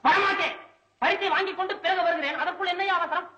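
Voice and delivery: high-pitched, impatient protest; impatient refusal. Acted. Poet called back before collecting his prize, impatient refusal.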